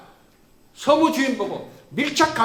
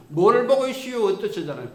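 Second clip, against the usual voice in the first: usual speaking voice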